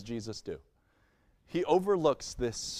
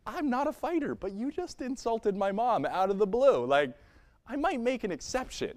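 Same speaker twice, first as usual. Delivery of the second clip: high, pitched-up mock outrage; mock indignation; 6 semitones higher than usual. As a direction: play the offended son, half-joking. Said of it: "I kinda was like".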